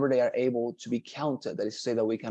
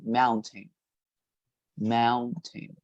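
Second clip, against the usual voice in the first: "Mountain" with the stress on "Moun"; stress on first syllable; much slower than usual. Shown as a demonstration. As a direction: hit "Moun" in "Mountain" and let "tain" fall away. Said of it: "The accent would be in the first syllable".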